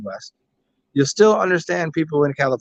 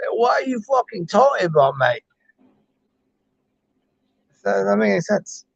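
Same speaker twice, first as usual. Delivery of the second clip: put-on higher-pitched British-style "mate" accent; hammed-up Brit or Aussie accent; mock British-Commonwealth accent; 4 semitones higher than usual. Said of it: "so you got Australian, British, Irish".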